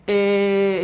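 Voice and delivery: ordinary talk, nothing marked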